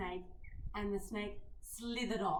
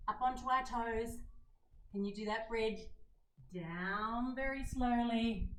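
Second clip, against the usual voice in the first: usual speaking voice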